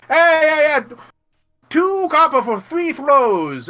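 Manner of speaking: high, loud, drawn-out carnival barker; eager barker. Acted. Stall barker calls to the player, high, loud and slow. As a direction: hawk the game at a passer-by.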